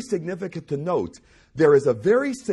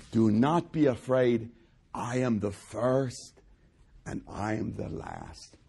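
slow, much softer, fading reassurance; Jesus tenderly comforting fallen disciple, soft and slow; gentle comfort; 6 semitones lower, 5 dB quieter, slower than usual. Acted. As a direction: soothe a frightened man.

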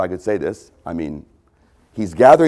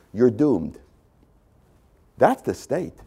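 ordinary talk, nothing marked

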